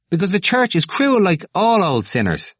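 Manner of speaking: high, indignant outburst; heated denunciation. A narrator's reading. Temple answering Glynn about the church, indignant. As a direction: fling out the accusation at high pitch.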